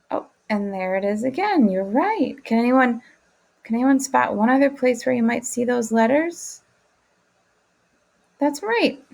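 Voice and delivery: ordinary delivery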